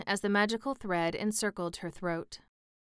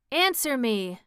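loud, high demand; commanding cry; 5 semitones higher, 5 dB louder than usual. A narrator's reading. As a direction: bark the order at her. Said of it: "cried the sorceress".

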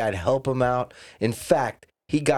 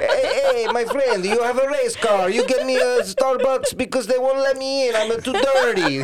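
high, louder put-on Italian accent; mock Italian accent; 11 semitones higher, 3 dB louder than usual. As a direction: beg a friend in a thick fake Italian accent. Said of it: "He talks like that".

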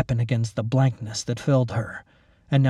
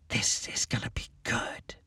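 gleeful whisper; 11 dB quieter than usual. Acted. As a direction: whisper it like a wolf grinning. Grins like a wolf at trap.

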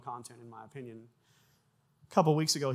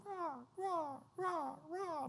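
high, soft bird cawing; bird noises; 7 dB quieter, much slower than usual. Retold or imitated. Keyboard girl making stage sound effects, high bird noises. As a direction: squawk softly like a seabird, high up. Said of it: "making bird noises".

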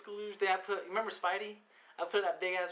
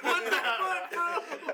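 high, laughing what-the; laughing disbelief; 10 semitones higher, much slower than usual. Retold or imitated. Seeing a friend's girlfriend photo, high laughing disbelief. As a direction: blurt it in laughing shock. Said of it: "I was just like".

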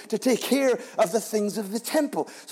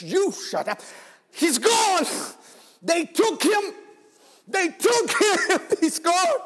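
crying out; 7 semitones higher, slower than usual. Retold or imitated. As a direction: cry out that he's gone. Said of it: "And she says".

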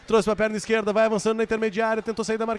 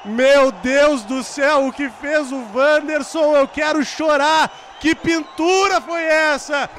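high, slow, shouted disbelief; excited goal commentary; 6 semitones higher, 3 dB louder, slower than usual. Real, not acted. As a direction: marvel at the goal, loud. Celebration after goal.

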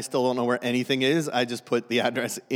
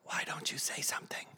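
wife's hushed whisper; whispering wife in church; 16 dB quieter than usual. Retold or imitated. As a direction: whisper it so nobody in the pews hears. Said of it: "And she'd be like".

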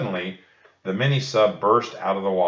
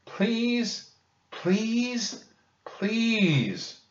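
much higher, softer begging please; pleading; 14 semitones higher, 3 dB quieter, much slower than usual. A narrator's reading. Annie begging the octopus, much higher and softer. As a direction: plead sweetly and high. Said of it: "she shouted".

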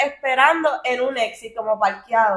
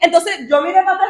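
normal talking voice

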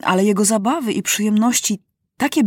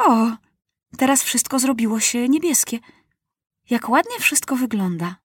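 put-on boy king delighted; 4 semitones higher than usual. A narrator's reading. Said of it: "I mówi".

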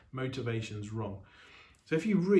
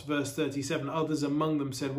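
ordinary talk

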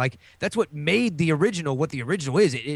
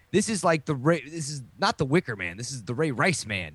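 normal talking voice

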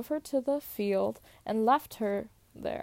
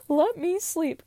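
sleepy whine; 6 semitones higher, 5 dB louder than usual. Acted. Lazy daughter begging to sleep.

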